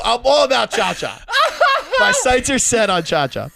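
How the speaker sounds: high, loud, breaking into laughter; laughing while talking. Real, not acted. Storyteller confessing a new crush to friends, laughing. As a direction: get it out through your laughter. Cracking up telling own story.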